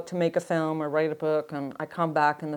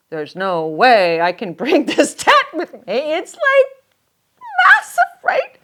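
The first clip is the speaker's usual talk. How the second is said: incredulous; 7 semitones higher, 7 dB louder, slower than usual. Retold or imitated. Hiker handed a huge tent, squealing that it is massive. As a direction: voice climbing very high on massive. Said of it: "thinking, first thought".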